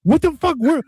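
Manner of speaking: high, hammed-up panic as lost aliens; panicked alien. Alien crew panicking about their own identity, high hammed-up voice. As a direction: squeak out a stunned realization. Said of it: "and they're like".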